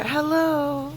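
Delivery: put-on high, loud little-boy hi; boy's greeting. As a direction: imitate the kid calling out hi. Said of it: "and he'll be like".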